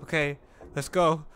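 usual speaking voice

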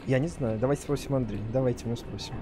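ordinary talk

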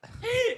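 very high squeal of laughter. Real, not acted. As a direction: lose it laughing. More laughing at the name.

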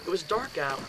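ordinary delivery

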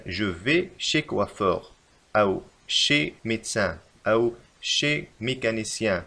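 slow, careful model phrases; slow dictation. Shown as a demonstration. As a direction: read each example slowly for students. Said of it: "نستعمل دائمًا la proposition chez".

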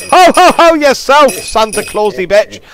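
loud high mocking ho ho ho; mock Santa. Gamer taunting a Santa monster, hammed-up ho ho ho. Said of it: "Santa Clausy bitch".

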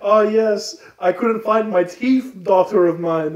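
high, slow, loud hammy vampire; pompous old count to his daughter; the count booms at his daughter in high, slow ham; grand vampire count. Acted. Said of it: "the Count's like".

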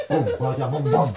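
low, spoken beatbox syllables; lightly voiced low beatbox; mock beatbox. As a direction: drop a playful beat with the mouth. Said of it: "or we can have a gangsta tea party".

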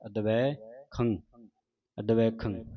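ਅਦਵੈ said with the half-vava sounded, ਦਵ together; ਅਦਵੈ with a clear ਵ sound after ਦ; pronunciation demo. Shown as a demonstration. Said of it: "ਇਹ ਵਾਵਾ ਹੀ ਉਚਾਰਨ ਹੋਣਾ ਹੈ".

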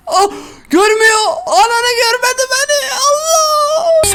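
near-scream of relief at escaping; relieved yelp. Real, not acted. Hiding streamer escapes the stalking woman, screaming with relief. Hiding from monster, not seen.

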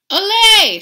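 loud high crowd cheer; shout. Acted. Crowd cheering, loud high shout. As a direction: roar it. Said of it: "shouted the crowd".

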